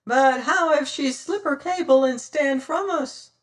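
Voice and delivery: high piping cry; piped. Acted. Smiling Sam pleading with the captain, high and piping. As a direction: pipe it up like a fat sailor. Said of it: "piped Smiling Sam".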